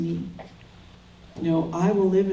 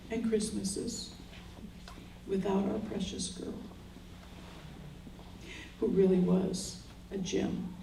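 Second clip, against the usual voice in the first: fading into tears; 11 dB quieter than usual. Real, not acted. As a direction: let it die away to a breathless whisper. Eulogy closes on lost daughter.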